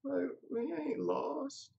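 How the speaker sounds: high, loud mock outrage, drawn out at the end; incredulous falsetto rant; baffled inner voice. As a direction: play the bewildered thought big and squeaky. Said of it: "I was sitting here thinking".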